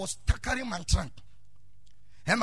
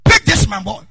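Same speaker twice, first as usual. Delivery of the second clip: senior ordering; 3 semitones higher, 10 dB louder than usual. Retold or imitated. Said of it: "He said".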